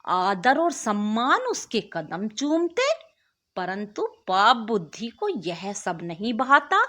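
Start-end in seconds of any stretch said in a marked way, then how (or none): none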